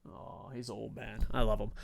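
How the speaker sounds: low, soft tender voice; soft cooing about the bird; gone soft. Real, not acted. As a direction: melt over the pet. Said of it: "I got all soft".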